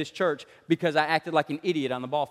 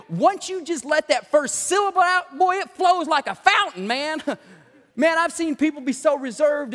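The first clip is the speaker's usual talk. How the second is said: preaching that climbs higher as it builds; raised, driving pulpit delivery; fired-up preaching; 6 semitones higher, 3 dB louder than usual. Real, not acted. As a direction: build the warning to a high-pitched peak.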